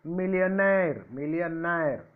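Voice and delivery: "millionaire" with a long, falling final "naire"; stress on last syllable. Shown as a demonstration. Teacher showing last-syllable stress, "millionaire" leaning on its end. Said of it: "stress mark at the last syllable".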